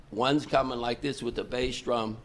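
usual speaking voice

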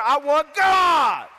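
fervent shout, slow and stretched; impassioned cry. Acted. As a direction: cry it out as a preacher's climax. Climax of the acted vow.

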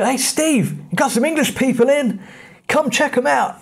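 high-pitched, drawn-up call to a mate; calling to Steve. Retold or imitated. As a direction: imitate a friendly local calling a friend over. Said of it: "He said that Steve would look after us".

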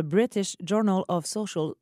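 put-on British-accented English character voice. Citing a study to her co-host, put-on British accent. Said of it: "T'as beaucoup amélioré ton anglais".

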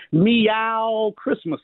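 drawn-out card greeting, said very slowly; reading the card aloud. Retold or imitated. Reading his manager's Christmas card aloud, very slow and drawn out. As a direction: stretch out each syllable of the card text. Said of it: "and it says".

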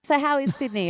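normal talking voice

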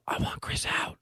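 urgent hoarse whisper; whisper yelling. Retold or imitated. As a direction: whisper it like a demand. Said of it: "It's hard to whisper into a radio microphone".

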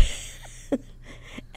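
soft, breathy chuckle; laugh. Real, not acted. Guest amused by her own birthday story, soft laugh. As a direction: chuckle quietly to yourself.

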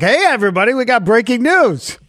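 cheerful, bright announcement of the news; gleeful news anchor. Retold or imitated. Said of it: "over at the Newsmax and, and Fox, it was like".

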